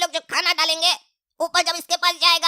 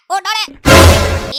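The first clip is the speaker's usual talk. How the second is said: long, very loud drawn-out "उठो रे"; loud drawn-out call; 10 dB louder, much slower than usual. Acted. Brother shouts at sleeping sibling, stretched-out loud call. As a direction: bellow it slowly to wake the sleeper.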